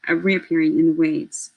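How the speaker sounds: ordinary delivery, nothing marked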